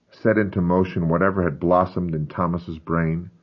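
plain reading voice

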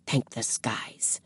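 breathless whispered thanks; whispered relief. A narrator's reading. Relieved aside.

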